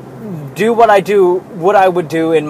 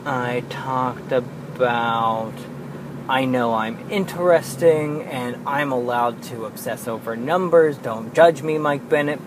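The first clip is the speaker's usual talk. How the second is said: normal talking voice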